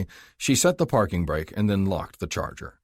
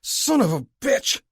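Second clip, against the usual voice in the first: much higher, loud, slow angry shout; drunk shout; 5 dB louder, slower than usual. A narrator's reading. Drunk shouting at the arresting cop, furious. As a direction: bellow at the cop. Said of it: "the drunk shouted".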